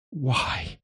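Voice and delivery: soft, high-pitched groan. A narrator's reading. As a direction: groan it out in quiet despair. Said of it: "she groans".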